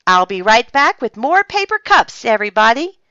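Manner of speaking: high, loud, showy announcement; proud. A narrator's reading. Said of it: "said Francine proudly".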